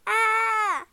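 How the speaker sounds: long, high, loud "aah"; falling scream. A narrator's reading. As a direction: scream as if toppling over. Princess about to fall in.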